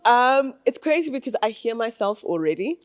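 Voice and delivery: usual speaking voice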